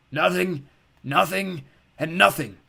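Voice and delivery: cracked creature voice. Acted. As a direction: grumble it out like a sick old creature.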